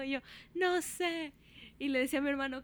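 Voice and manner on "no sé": high retold helpless cry; hammed-up high whine; panicked wail. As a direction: plead ignorance in a mock panic. Imitates own panicked answer to mother.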